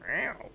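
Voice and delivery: short soft rising meow; a little quiet meow; soft cat meow. Pretend. Streamer adding one last quiet cat noise. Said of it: "can't not do cat noises".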